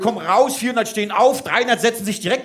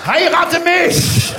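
mock proposal shout; 9 semitones higher, 5 dB louder, much slower than usual.

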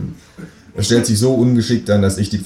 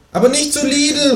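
high kangaroo voice calling out; kangaroo calling after. Acted. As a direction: call after him in the kangaroo voice. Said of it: "ruft mir das Känguru hinterher". (hohe Känguru-Stimme, hinterherrufend)

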